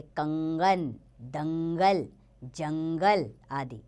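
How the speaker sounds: teacher sounding out कंगन so the nasal is heard; slow classroom demo of कंगन दंगल जंगल; nasal sounds shown slowly. Shown as a demonstration. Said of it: "इनका उच्चारण नाक से होता है".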